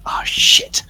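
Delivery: hushed, voiceless curse; whispered dread. Acted. Adventurer seeing the mob run towards them, whispered curse. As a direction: whisper it as trouble comes your way. Player's character sees the mob coming.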